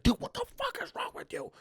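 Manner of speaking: high-pitched imitation of the angry organizer. Organizer to the comic after the show, high mock scolding. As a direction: scold him in a high put-on voice. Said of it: "the guy's like".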